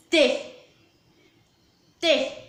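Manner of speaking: "T" dito sozinho, curto e mais forte; isolar o som T. Shown as a demonstration. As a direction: solte só o som do "T", destacado. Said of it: "O T e o E produzem o som". (professora isola o som "T" para as crianças)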